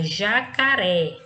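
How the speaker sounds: "jacaré" slowly, with RÉ strong and falling; stress on final syllable. Shown as a demonstration. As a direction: land firmly on "ré" in "jacaré". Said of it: "a sílaba pronunciada com mais intensidade é a última".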